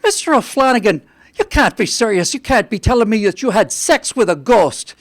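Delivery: put-on the priest gasping at an old parishioner; shocked Irish priest. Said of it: "with an Irish accent".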